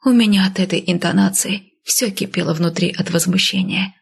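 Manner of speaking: ordinary delivery, nothing marked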